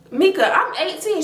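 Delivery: high, pleading mock excuse; whiny defense. Caught cousin excusing herself to Mika, high whiny plea. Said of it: "Cece just like".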